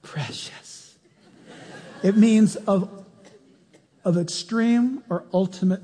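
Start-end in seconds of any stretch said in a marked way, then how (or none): 0.0-0.9 breathy whisper, much softer; whispered word. Shown as a demonstration. Savoring the word precious.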